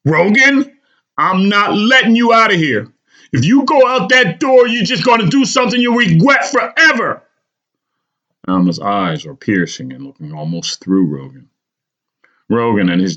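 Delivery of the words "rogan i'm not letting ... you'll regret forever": higher, louder unyielding mother; a mother blocking the door against her son; insistent. A narrator's reading. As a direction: refuse to budge, firm and urgent. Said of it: "Alma is insistent and will not give an inch".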